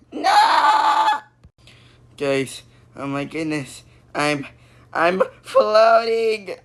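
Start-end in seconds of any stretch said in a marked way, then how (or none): 0.1-1.2 high, drawn-out shriek; over-the-top wailed protest; dramatic scream. Acted. 4.9-6.6 high, slow, drawn-out cry; stretched-out cartoon panic; panicked wail. Reacts to floating away.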